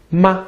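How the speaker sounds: "ma" short and high, unaccented; neutral tone. Shown as a demonstration. Teacher introducing the question word, neutral-tone "ma". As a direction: say "ma" briefly, with no tone contour. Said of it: "This is called the neutral tone".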